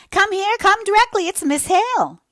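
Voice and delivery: very high, loud summoning call. A narrator's reading. Landlady summoning her daughter to the door, loud high call. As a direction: shout for her to hurry. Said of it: "calling to her daughter".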